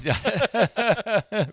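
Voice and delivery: big burst of laughter; loud laugh. Real, not acted. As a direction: laugh out loud, high and hearty.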